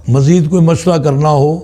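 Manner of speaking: ordinary delivery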